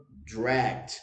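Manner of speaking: "Dragged" as one syllable ending in a T sound; dragged, repeat after me. Shown as a demonstration. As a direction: model "Dragged" for students to repeat. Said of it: "Repete aí, ó". ("Dragged" dito em uma sílaba, final em T)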